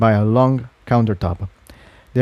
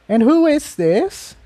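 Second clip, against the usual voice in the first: put-on much higher curious young mare; Aurora's voice. A narrator's reading. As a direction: greet the stranger with bright curiosity. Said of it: "Aurora said".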